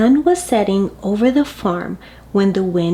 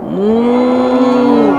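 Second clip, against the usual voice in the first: loud, long moo; moaning cow; 2 semitones higher, 5 dB louder than usual. A narrator's reading. Cow hears the wind from the barn, moaning moo. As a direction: moan it out like a cow. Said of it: "cow moaned".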